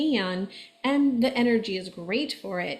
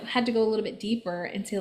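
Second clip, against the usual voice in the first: ordinary talk, nothing marked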